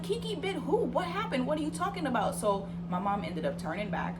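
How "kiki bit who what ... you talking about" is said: high-pitched mock incredulous questions; alarmed disbelief. Mom on the phone hearing of the bite, shocked. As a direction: imitate a shocked mother demanding answers.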